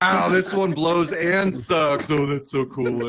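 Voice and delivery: drawn-out, awestruck wow; mock excitement. Excited over a new leaf blower, in slow mock wonder. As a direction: marvel slowly, hammed-up adult geeking out. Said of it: "caught myself getting excited".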